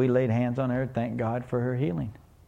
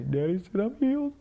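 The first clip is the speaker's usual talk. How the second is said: little daughter; 6 semitones higher than usual. Retold or imitated. Daughter repeating to her mother.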